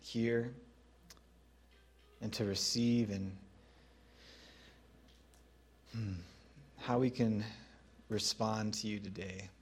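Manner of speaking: closing prayer before the sermon, softly spoken. Acted. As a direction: pray it gently and unhurried.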